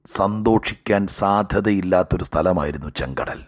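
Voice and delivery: usual speaking voice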